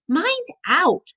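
high-pitched warning; alarmed cry. A narrator's reading. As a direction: cry out for him to watch it. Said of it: "cried Mister Ellis".

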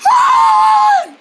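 very high wordless shriek; piercing high squeak; lost-voice squeal. Acted. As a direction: squeal in place of talking. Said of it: "she must have lost it, her voice".

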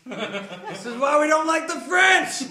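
high, loud, slow holler; mock road-rage insult yelled high; shouting. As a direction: shout it out the car window. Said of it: "I just shout shit".

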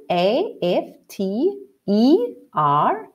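slow, sing-song letter naming; slow spelling. Shown as a demonstration. Teacher spells the word for the class, slow and sing-song. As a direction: spell out the letters of afternoon slowly, one by one. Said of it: "How do we spell afternoon?".

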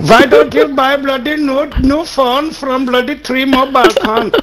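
put-on Indian accent. Telling them to buy a phone, in a mock Indian accent. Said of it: "An Indian one".

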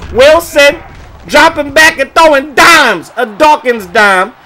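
high, loud, slowed hype on the dime; excited touchdown call. Real, not acted. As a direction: shout the touchdown home. Backup QB throws touchdown.

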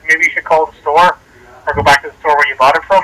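ordinary talk

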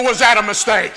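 normal talking voice

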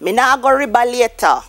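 "river" said "riba", a B in place of the V and no final R; a quick Creole line with "river" as "riba". Shown as a demonstration. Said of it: "put the B in the V".

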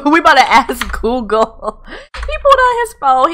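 high, loud, cracking up; laughing. Real, not acted. Player amused Sim will ask Google, laughing. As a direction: talk through a burst of laughter.

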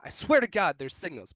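insistent self-defence. Real, not acted. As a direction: insist on it, pitch riding high. Defending his reading of her signals.

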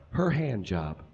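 usual speaking voice

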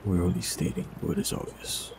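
ordinary talk, nothing marked

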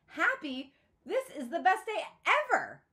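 high, loud, gushing joy; ecstatic. A narrator's reading. Girl to her father, ecstatic over her new phone. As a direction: shout it with pure delight.

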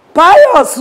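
high, loud call of the name; urgent shout. Acted. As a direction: shout for someone not answering. Calling out, no answer.

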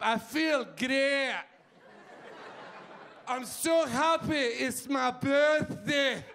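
slow, high Scottish growl of happy words; happy news barked in a furious Scottish accent; angry-sounding Scottish accent. Acted. Comic showing happy Scots sounding angry, angry Scottish accent. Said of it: "Why do Scottish people sound angry no matter what they say?".

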